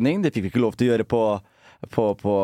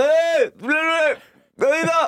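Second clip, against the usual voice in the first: put-on drunken calling out; much slower than usual. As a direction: call her in from the bedroom. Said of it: "Så ropte jeg sånn".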